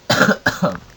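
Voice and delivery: quick unvoiced cough; a real cough mid-sentence. Real, not acted. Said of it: "I'm coughing way too much".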